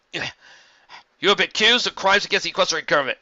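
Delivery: loud, high official pony voice; formal charge from a soldier; stern soldier. A narrator's reading.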